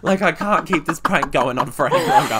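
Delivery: ending the fake-alcohol prank, she talks while laughing. Real, not acted. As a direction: still laughing as she explains. Laugh heard.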